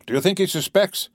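innocent question, lifted high; charming artlessness. A narrator's reading. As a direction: play it guileless and sweetly naive. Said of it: "with charming artlessness".

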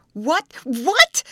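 high, loud shocked questioning; startled cry. Acted. Betty startled by the news, crying out in disbelief. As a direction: blurt it out in disbelief. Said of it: "cried Betty".

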